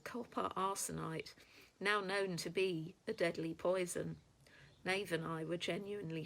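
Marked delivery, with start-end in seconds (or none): none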